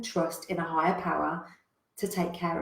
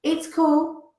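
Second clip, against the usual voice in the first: breezy, dismissive refusal, higher and louder; casual brush-off; 6 semitones higher, 6 dB louder than usual. Retold or imitated. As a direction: wave them off without a care. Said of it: "And he kept saying".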